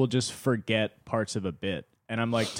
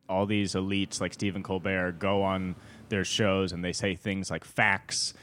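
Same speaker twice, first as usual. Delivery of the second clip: ordinary talk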